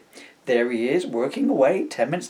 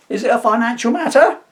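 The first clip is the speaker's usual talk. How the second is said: posh butler voice; 9 semitones higher, 8 dB louder than usual. Acted. Butler voice at the door.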